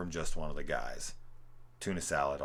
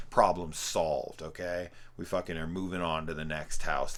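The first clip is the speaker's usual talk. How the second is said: usual speaking voice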